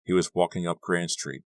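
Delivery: plain reading voice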